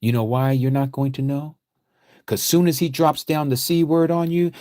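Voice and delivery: ordinary delivery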